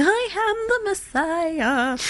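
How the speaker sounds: high, slow, hammed-up announcement; mock grand proclamation. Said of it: "didn't run around going".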